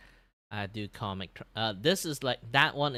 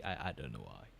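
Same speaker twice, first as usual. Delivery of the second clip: low, soft everyday voice; relaxed lower voice; 8 semitones lower, 8 dB quieter than usual. Real, not acted. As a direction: drop into a relaxed tone. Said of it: "I used back this tone".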